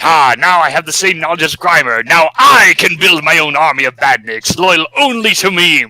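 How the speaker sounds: high, loud triumphant villain boast; mad scientist. Retold or imitated. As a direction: gloat over the new evil army. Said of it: "So it's like".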